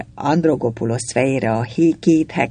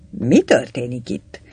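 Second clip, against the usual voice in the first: plain reading voice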